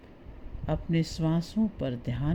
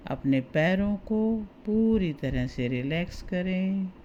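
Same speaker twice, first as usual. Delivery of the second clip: guided meditation. Acted. Guide talks listener through relaxing the body for sleep, guided meditation. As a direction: ease the listener towards sleep, stretching key words.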